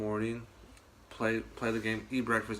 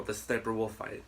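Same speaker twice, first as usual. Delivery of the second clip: ordinary talk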